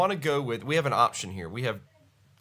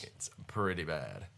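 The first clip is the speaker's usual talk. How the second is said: low, congested and slow; deep stuffed-up sinus voice; stuffy bass voice; 6 semitones lower, 8 dB quieter than usual. Real, not acted. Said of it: "significant sinus drainage".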